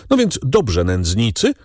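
usual speaking voice

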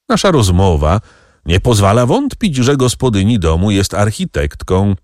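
ordinary delivery

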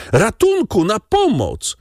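very high, panicked woman's cry; cry for help. A narrator's reading. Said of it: "krzyknęła panna Darmilly". (wysokie wołanie o ratunek)

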